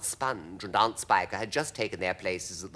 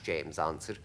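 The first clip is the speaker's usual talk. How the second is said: plain reading voice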